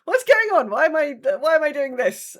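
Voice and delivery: ordinary talk, nothing marked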